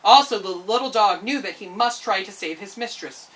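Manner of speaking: plain reading voice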